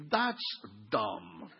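blunt verdict with a long pause before the low last word; deadpan put-down. Pretend. Pastor tells a church member what he thinks, deadpan put-down. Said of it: "I will just say".

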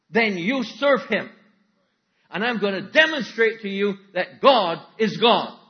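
high, loud, slow ultimatum; preacher booming Elijah's dare; loud prophet's challenge. Acted. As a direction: lay down the ultimatum to the crowd slowly.

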